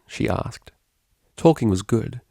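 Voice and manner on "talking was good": plain reading voice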